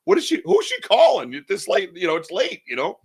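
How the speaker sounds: put-on high, anxious child's voice; a worried little boy asking his mom questions; little kid voice. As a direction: pitch up like a puzzled kid. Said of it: "like a little kid going".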